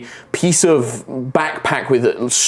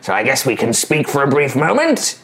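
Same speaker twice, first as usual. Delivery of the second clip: higher, condescending goblin voice, touches of threat; smart goblin; 5 semitones higher than usual. Acted. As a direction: sound like a clever goblin tired of fools.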